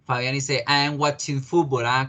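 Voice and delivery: normal talking voice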